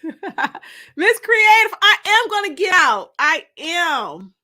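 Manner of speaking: high, loud burst of excitement; excited squeal. Real, not acted. Host squealing to viewers about going out. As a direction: squeal it out, thrilled.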